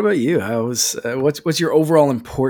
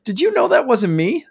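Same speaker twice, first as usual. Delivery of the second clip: high-pitched, mock-innocent sassy droid; sassy droid sarcasm; 11 semitones higher than usual. Co-host quoting K-2 after the shooting, sassy and sarcastic. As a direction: imitate a droid's sarcastic question, pitched well up. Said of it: "just that one bit of sarcasm".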